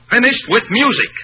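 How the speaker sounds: defiant outburst; angry repeat. Acted. Repeats it harder.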